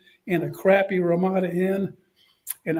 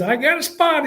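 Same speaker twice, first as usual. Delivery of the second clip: higher-pitched coach voice; Spurrier voice; 8 semitones higher, 4 dB louder than usual. Retold or imitated. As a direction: say it up high like the coach. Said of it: "He says".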